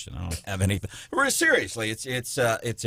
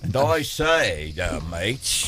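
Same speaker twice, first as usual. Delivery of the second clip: slow, hammed-up British regal accent; mock Queen of England; 3 semitones lower, much slower than usual. Host joking that Kamala sounds like the Queen of England, in a mock British accent. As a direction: lightly put on a posh British accent, very slow. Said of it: "trying to be the Queen of England".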